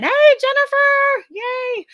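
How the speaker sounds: light up and sing out the name; excited greeting. Real, not acted. Greeting a viewer who joined the stream, excited and high.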